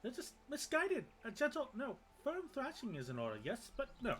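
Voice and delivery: high, loud, frantic little Moogle voice; pleading Moogle. Acted. Small Moogle pleading with adventurers not to kill its kin, high and frantic. Moogle begging heroes to spare them.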